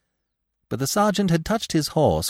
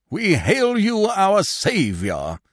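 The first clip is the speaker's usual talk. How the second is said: much higher, slow theatrical proclamation; grand declaiming; 10 semitones higher, 3 dB louder, much slower than usual. A narrator's reading. Actor Pantaloon greets his saviour, slow grand declaiming. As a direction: hail him like a stage hero. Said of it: "the big man declaimed".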